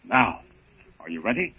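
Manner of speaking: slow, hushed dramatic build; stretched teaser line, high and slow; suspense announcer. Acted. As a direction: draw it out to hold the listener. Announcer building suspense before ceremony.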